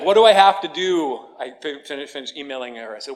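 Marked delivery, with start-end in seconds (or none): 0.0-1.2 loud, high exasperated outburst; raised voice, pleading for an answer; exasperated plea. Retold or imitated. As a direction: burst out in desperate frustration. Acting out his frustrated plea.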